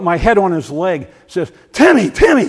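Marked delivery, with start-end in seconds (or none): 1.7-2.5 high, loud cry of a name; panicked shout to a friend on the ground; frantic calling out. Retold or imitated. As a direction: call him urgently, much higher and louder. Friend calls to fallen man.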